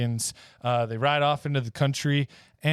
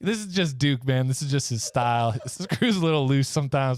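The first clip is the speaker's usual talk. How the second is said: normal talking voice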